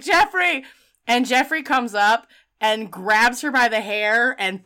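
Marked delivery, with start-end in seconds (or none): 0.0-0.6 higher, pained call of the name; guilty cry of name. Retold or imitated. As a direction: cry out in remorse. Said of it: "And she's like".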